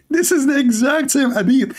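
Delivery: high-pitched, amused disbelief; laugh, then say it high through the amusement. Real, not acted. Mocking the same hadith to viewers, laughing.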